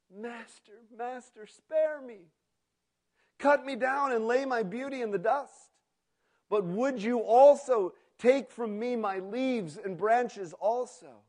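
high, pained begging, rising loud; pleading. A narrator's reading. Bamboo begs the master to spare his branches, high and pleading. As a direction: cry out for mercy.

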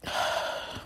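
real, soft sigh on the edge of tears; a quiet, trembling exhale; shaky breath out. Real, not acted. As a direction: let the breath go as the tears come. Emotional pause after admitting loneliness.

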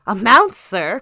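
loud, scoffing echo; blustering squire's exclamation; indignant cry. A narrator's reading. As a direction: burst out at the very question. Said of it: "cried the squire".

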